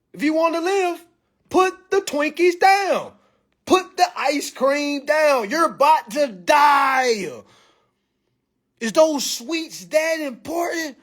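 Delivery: slow pleading shout. Acted. Telling a sick family member to quit sweets, slow pleading shout. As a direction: stretch every word out like a desperate warning.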